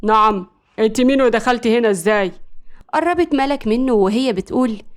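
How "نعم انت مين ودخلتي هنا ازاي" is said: startled, firm challenge; taken aback by a stranger; shocked. A narrator's reading. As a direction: demand an explanation from an intruder. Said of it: "وقال بصدمة".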